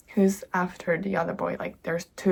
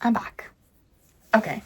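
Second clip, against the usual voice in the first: whispering; 3 semitones higher, 6 dB louder than usual. Real, not acted.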